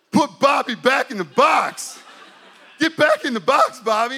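loud, high, forceful command; big hammed-up holler; shouted order. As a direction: yell at your wild alter ego to retreat. Ordering his alter ego back, shouted.

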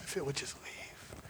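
breathless whisper, almost no voice; whispered plea. Retold or imitated. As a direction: let the wish slip out as a whisper. Pain leaving through tears.

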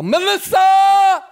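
long, high shout across the offices; big yell. Retold or imitated. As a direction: call her name at the top of your lungs from three rooms away. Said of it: "So I do a big yell".

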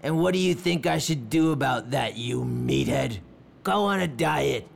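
high, scornful villain voice; mock the meathead with contempt; sneering villain. Nottingham mocking henchman.

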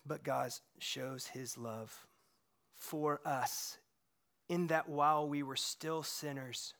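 slow, soft, low reverent reading; scripture reading. Acted. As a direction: read the verse slowly and reverently.

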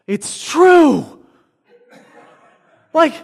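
high, loud burst of astonishment; imitated awestruck student, big and high; amazed exclamation. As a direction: shout it like a stunning discovery. Student amazed by faked proof.

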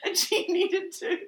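loud, laughing rant; mock-outraged while laughing. Real, not acted. Laughing summary of the review.

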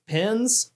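"pins" with a short i, as said for pens; Southern pin-pen merger. Shown as a demonstration. Telling co-hosts of calling pens "pins" in Boston, pin-pen merger. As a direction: say "pins" where pens is meant. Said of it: "I started calling pens".

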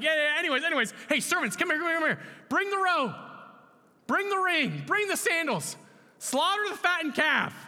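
high, loud calls to the servants; excited father. Acted. Father hurrying servants to celebrate his son's return, excited shouting. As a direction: wave it off, then shout orders urgently. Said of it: "The father's just like".

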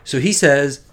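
ordinary delivery

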